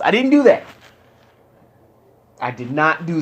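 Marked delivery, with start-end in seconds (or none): 0.0-0.6 emphatic denial. Real, not acted. Insists he followed the plan.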